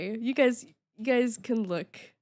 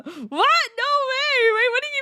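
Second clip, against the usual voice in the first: high, loud shocked "no way"; shocked disbelief; 11 semitones higher, 6 dB louder than usual. Real, not acted. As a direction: blurt it out in real disbelief. Reacts to chat reveal.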